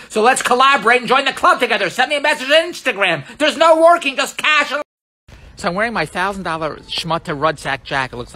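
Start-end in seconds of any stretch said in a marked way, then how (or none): 0.1-4.7 much higher and louder, selling hard; rising to a near shout over the club pitch; excited pitch. Real, not acted. Pitching followers to join his club, excited shout.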